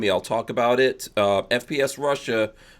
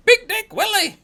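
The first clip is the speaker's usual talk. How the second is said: high-pitched, louder mock call; mock high yell; 5 dB louder than usual. Host imitates Walter going around England, high mock holler.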